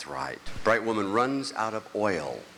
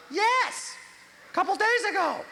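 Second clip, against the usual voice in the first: much higher, lilting; high mock wife voice. Wife admitting she ignored the oil light, high and breezy. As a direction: play her breezy and unbothered. Wife's reply acted out.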